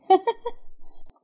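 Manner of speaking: high unvoiced giggle; breathy giggle. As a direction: let out a high airy laugh. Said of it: "ميتة من الضحك". (ضحكة عالية مكتومة)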